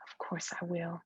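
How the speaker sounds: usual speaking voice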